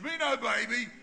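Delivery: put-on loud little-boy voice, pleased with himself; a toddler blaming Nana, cheeky. Grandson voiced again.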